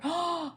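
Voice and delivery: quick high shocked gasp; sharp gasp. Real, not acted. Hearing daughter sold her scooter, a sharp gasp.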